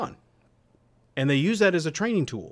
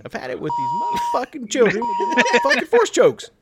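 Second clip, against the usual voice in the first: much higher, louder, fired-up Samuel Jackson rant; Samuel Jackson impression; 5 dB louder than usual. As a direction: channel Samuel Jackson losing it over the kids. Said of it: "Samuel Jackson's character".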